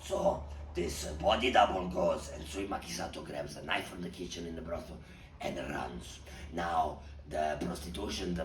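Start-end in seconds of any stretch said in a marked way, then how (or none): none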